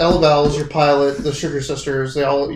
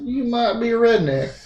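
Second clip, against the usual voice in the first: higher-pitched Southern punchline; real Southern; 7 semitones higher than usual. Acted. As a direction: land it in a playful Southern accent. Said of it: "I go real Southern".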